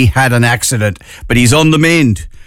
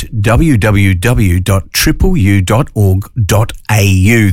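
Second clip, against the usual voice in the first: slow, careful web address; slowly spelled out; 4 semitones lower, slower than usual. Shown as a demonstration. Radio host spelling the web address for listeners, slow and careful. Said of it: "I'll say that again slowly".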